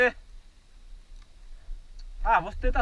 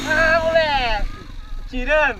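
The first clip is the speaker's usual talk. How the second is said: excited shout; 4 semitones higher, 7 dB louder than usual. Real, not acted. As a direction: shout with pride. Celebrating the climb. (comemorando alto)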